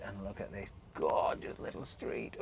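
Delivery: "little" leaned on a touch; gentle emphasis on "little"; stress on little. Real, not acted. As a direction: give the word "little" a light extra push. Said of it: "Oh, emphasis on the word little".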